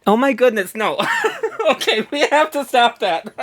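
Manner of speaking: loud, giggly protest; real flustered laughter; laughing outburst. Real, not acted. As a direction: burst out laughing while calling a halt. Breaks off the whisper bit giggling.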